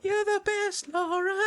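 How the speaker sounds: very high mock fan cheering; a high, hammed-up fan praising Lara; adoring fan. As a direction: cheer her on in a squeaky fan voice.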